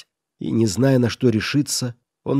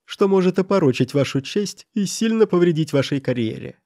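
higher-pitched, spiteful add-on; sly, venomous jab in a raised pitch; snide; 6 semitones higher than usual. A narrator's reading. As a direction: add it maliciously, needling him. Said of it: "ехидно добавил".

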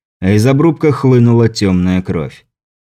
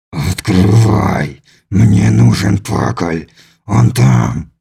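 low, slow inhuman voice; slow and threatening, from deep down; menacing alien; 3 semitones lower, much slower than usual. A narrator's reading. (низкий зловещий голос чужака)

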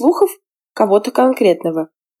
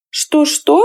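threatening; 8 semitones higher than usual. A narrator's reading. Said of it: "с угрозой в голосе".

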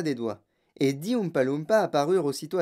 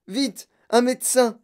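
loud, high call for help; urgent shout; 7 semitones higher, 5 dB louder than usual. A narrator's reading. Father summoning a doctor, urgent shout. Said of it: "cria monsieur Beauregard".

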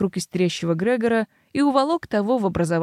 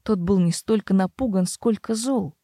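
ordinary delivery, nothing marked

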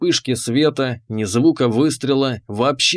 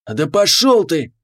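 yelling; 3 semitones higher than usual. A narrator's reading. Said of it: "заорал он".

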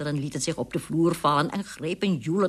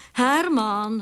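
raised, higher calling voice; 5 semitones higher, 6 dB louder than usual. A narrator's reading. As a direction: call for your husband. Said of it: "riep ze".

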